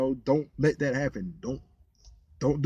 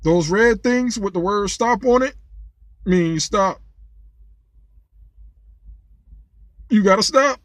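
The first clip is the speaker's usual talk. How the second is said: higher, louder mock cop lecture; imitating the cop; 5 semitones higher, 5 dB louder than usual. The cop lectures the driver about a stop sign, mock condescending. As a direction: talk down like a smug cop. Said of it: "The cop in the passenger seat says".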